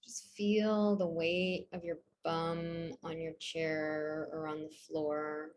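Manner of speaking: soft, low-key breathing guidance; calm meditation voice with long, restful pauses; guided meditation. Real, not acted. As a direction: ease them into stillness, softly and unhurried.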